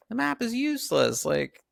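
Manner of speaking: high, loud dismissive voice; mock Mike. Mike scoffing at the useless map, high and loud. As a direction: wave the map away scornfully. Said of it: "him saying".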